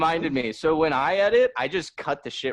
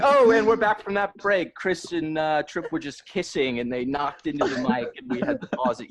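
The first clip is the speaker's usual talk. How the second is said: higher, chirpy fake host announcement; mock podcast host; 4 semitones higher than usual. Shown as a demonstration. Podcast host faking a break excuse, chirpy and higher. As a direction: play a cheery host back from a break. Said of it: "It's like".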